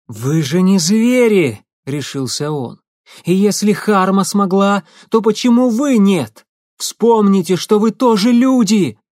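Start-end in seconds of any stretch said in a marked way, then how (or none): 0.1-1.6 slow, loud plea to beasts; loud appeal. Acted. Prish faces the werewolves. 3.2-9.0 high, loud desperate appeal; shouting plea. Acted. Prish appeals to the werewolves.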